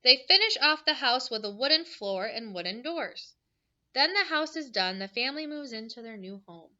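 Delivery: bright storybook read-aloud; reading to children. Acted. Teacher reading a book to her class, bright and lively.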